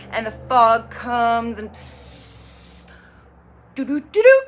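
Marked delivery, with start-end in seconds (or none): 1.7-2.9 soft, breathy "psst" of a fog machine; quiet imitated jet of fog; imitated fog hiss. 3.8-4.4 high, loud sung-out "doo-doo" jingle; bright electronic-style jingle done by mouth; imitated chime. Imitating the chime announcing Lana.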